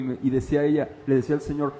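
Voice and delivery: usual speaking voice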